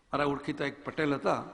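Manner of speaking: ordinary delivery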